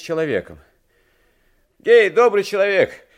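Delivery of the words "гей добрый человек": high, very loud call; shouted hail. A narrator's reading. Coachman hails a stranger met in the snow, shouting. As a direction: hail a stranger across the wind. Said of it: "закричал ему ямщик".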